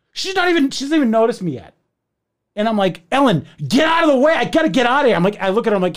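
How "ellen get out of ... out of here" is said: high retold shout; acted-out frantic demand; urgent shouting. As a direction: yell at her to clear the way. Yelling at neighbour blocking stairs.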